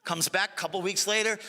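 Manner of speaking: ordinary delivery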